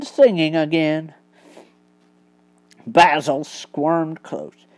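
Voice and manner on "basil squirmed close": ordinary delivery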